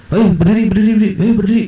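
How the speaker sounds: high-pitched pestering voice; mock urging. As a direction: imitate someone urging everyone up. Retells people urging him to stand.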